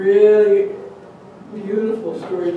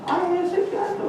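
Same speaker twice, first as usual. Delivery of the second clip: put-on child sighing; 12 semitones higher than usual. Boy relieved at home.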